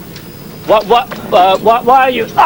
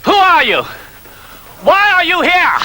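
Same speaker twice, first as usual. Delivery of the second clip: raised, sharp interrogating voice; commanding officer. Acted. Officer challenging an intruder on set, sharp and raised. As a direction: demand it of the intruder.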